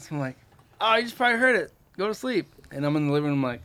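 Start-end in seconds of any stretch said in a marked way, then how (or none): 0.8-2.5 higher, breezy brush-off; acted high-voiced reassurance; feigned nonchalance. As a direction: play it down in a raised pitch. Hiding his worry from his wife.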